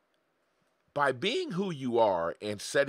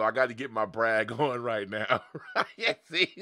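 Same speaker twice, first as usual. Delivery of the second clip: laughing; 3 semitones lower than usual. Real, not acted. Laughs while bragging.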